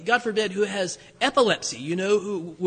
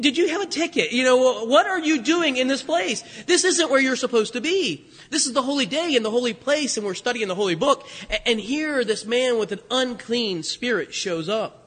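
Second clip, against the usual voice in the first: whoa, who let you in here; startled outrage; 2 semitones higher than usual. Pretend. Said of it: "you almost want to say".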